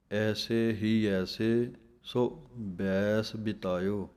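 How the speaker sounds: slow, with a pause after ਸੋ before ਬੈਸ ਬਿਤਾਇਓ; pause in the line. Shown as a demonstration. Teacher showing students where to pause, ਸੋ then ਬੈਸ ਬਿਤਾਇਓ. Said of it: "ਕੈ ਦੇ ਉੱਤੇ ਵਿਸਰਾਮ ਹੈ".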